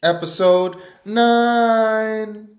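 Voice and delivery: high, loud, slow stretched announcement; booming announcer calls "nine" long and high; drawn-out hype. Acted. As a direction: stretch "Episode nine" out like a big title card. Hyped title then retake.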